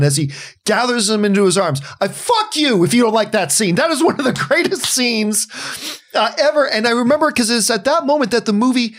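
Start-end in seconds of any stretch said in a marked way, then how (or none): none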